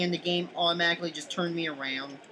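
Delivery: usual speaking voice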